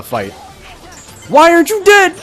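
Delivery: ordinary talk, nothing marked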